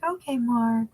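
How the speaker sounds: a hint of a cutesy baby voice. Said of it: "with her little baby voice".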